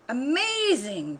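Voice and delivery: slow, high, loud amazed cry. Acted. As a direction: stretch it out in wonder. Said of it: "cried Elephant Mighty".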